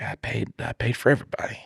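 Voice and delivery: fast guilty whisper; sheepish whisper. Retold or imitated. Husband confesses to his wife, whispered and fast.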